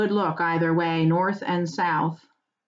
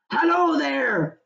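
slow, higher hail into the fog; farmer calling out; 7 semitones higher, slower than usual. Acted. Farmer hails a rider in the mist, slow call. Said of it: "called Farmer Maggot".

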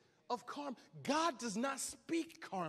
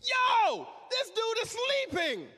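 loud shout to wake a sleeper; 9 semitones higher, 6 dB louder than usual. Acted. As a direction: yell to rouse a sleeping man. Preacher voicing sailors waking Jonah.

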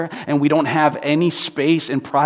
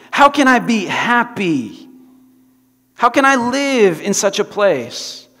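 slow, high, pleading question; lightly voiced despair; 7 semitones higher, 5 dB louder, slower than usual. Corrie lamenting in the camp, slow and high.

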